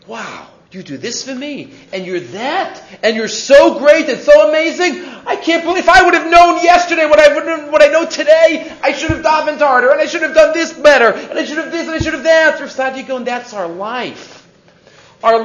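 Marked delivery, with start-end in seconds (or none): 0.1-2.8 hushed wonder. Retold or imitated. Rabbi's inner wonder at God. 3.0-12.6 high, mostly loud outpouring; overwhelmed regret. Retold or imitated. Rabbi realizing God's greatness anew, overwhelmed with regret.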